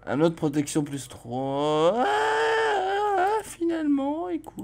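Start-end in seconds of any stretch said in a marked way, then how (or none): 2.0-3.4 very high shrill cry; high-pitched cry; shrieked ah. Real, not acted. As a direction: squeal it out.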